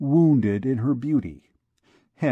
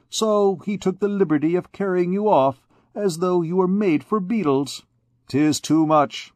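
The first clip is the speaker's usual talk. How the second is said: higher, boastful officer rattling on; swaggering captain; 3 semitones higher than usual. Acted. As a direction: brag about the rescue to the girl. Captain voiced throughout.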